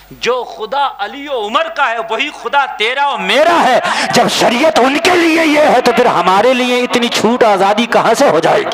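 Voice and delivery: impassioned sermon climax; full-force preaching. Real, not acted. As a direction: build the argument to a shout.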